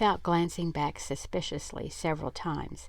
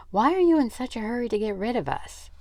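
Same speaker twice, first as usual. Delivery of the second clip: suspicious; 6 semitones higher, 5 dB louder, faster than usual. A narrator's reading. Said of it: "asked Gillen suspiciously".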